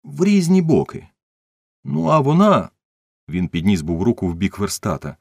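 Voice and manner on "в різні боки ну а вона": high-pitched, flustered explaining; agitated witness. A narrator's reading. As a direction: explain urgently, voice pushed well up. (схвильовано, високим голосом)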